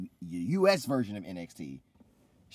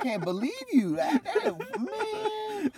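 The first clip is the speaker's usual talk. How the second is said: much higher, louder, laughing disbelief; upset laughing protest; 6 dB louder, much slower than usual. Real, not acted. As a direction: protest while laughing hard. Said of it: "Sonny is visibly upset right now".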